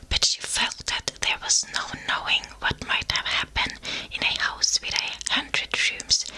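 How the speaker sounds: ordinary delivery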